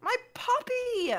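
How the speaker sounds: high, drawn-out indignant cry; whiny, stretched-out insistence; exasperated repeat. Real, not acted. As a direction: repeat it slowly for someone not getting it. Exasperated repeat after being misheard.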